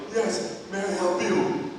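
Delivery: slow, mimicked laryngitis; imitated hoarse drive-thru worker; hoarse intercom voice. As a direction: offer help in a put-on hoarse voice. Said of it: "After hearing her voice".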